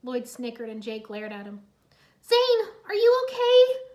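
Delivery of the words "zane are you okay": high, loud, slow worried call; anxious cry. Acted. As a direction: call out in fear. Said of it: "Irene cried".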